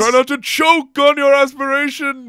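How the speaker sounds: angry crying. Pretend. Quoting Star Wars lines.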